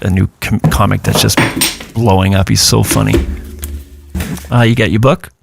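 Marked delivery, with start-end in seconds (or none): none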